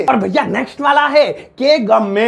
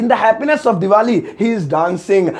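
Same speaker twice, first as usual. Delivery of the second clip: slow repeat; much slower than usual. Shown as a demonstration. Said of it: "English में कहेंगे".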